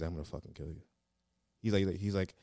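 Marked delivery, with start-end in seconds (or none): 0.1-0.8 imitating a low, softly spoken threat; low quiet threat. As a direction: deliver the threat low and under your voice. Retold threat from drill instructor.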